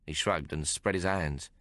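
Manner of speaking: usual speaking voice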